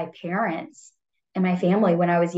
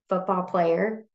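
ordinary delivery

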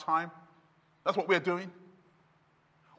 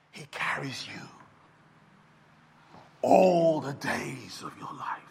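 hushed whisper; 6 semitones lower than usual. Acted. Tender sermon climax, hushed.

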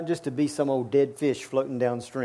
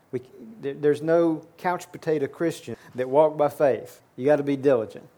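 usual speaking voice

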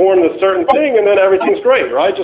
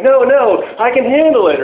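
higher, eager knight's protest; Galahad protesting; 7 semitones higher than usual. Retold or imitated. Galahad protesting to his rescuers, higher and keen. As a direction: play the eager knight who wants to stay.

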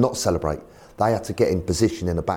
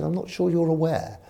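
high-pitched posh imitation; a lightly voiced posh chairman; 9 semitones higher than usual. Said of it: "He's very posh".

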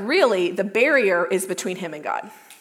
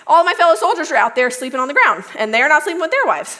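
fast and louder rerun of "all of my fellow soldiers"; quick redo; 4 semitones higher, 5 dB louder, faster than usual. Retold or imitated. Preacher redoing Uriah's quote after blanking, rushed. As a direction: rattle the fixed line off in a rush. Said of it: "couldn't think of soldiers for a second".

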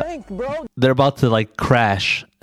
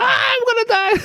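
panicked scream. Retold or imitated. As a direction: shriek like falling off a cliff. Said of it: "panicking, like".

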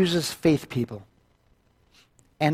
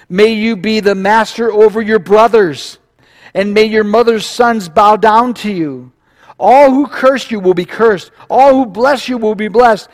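proclaimed blessing, higher and louder; Isaac; 5 semitones higher, 5 dB louder than usual. A narrator's reading. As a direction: pronounce the blessing with rising force. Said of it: "This is the blessing coming from Isaac to Jacob".

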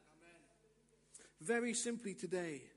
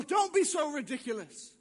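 loud, raised-pitch rebuke; scornful scolding; 6 semitones higher, 8 dB louder than usual. Acted. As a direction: shout down the idea. Lie scolds the would-be healer.

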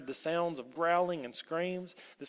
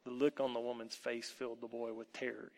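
ordinary delivery, nothing marked

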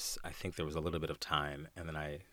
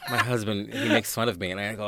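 usual speaking voice

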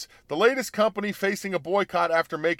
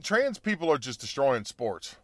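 ordinary talk

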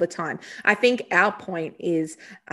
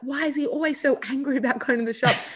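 a light higher puzzled dad voice; 6 semitones higher than usual. Retold or imitated. The separated dad asking why his son is so angry, lightly voiced puzzled dad. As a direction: wonder aloud, baffled and a bit exasperated. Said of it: "the dad is like".

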